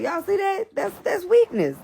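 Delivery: high, excited exclaim; delighted. Real, not acted. Child runs in, mother delights.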